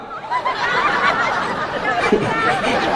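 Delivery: real burst of high laughter; big shrieking laugh. Real, not acted.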